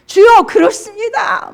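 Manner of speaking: high, loud shout of faith; fervent cry. Acted. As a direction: call out the answer wholeheartedly. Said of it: "막 이렇게". (높고 크게 외치는 믿음의 고백)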